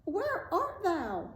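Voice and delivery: high, drawn-out witch's call; long, high searching call. A narrator's reading. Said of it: "so she called again".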